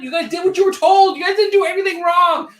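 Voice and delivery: high, loud mock-sweet voice; hammed-up cooing at the chat, high; mock indulgent defender. Co-host playfully excusing chat for obeying, in a high cooing voice.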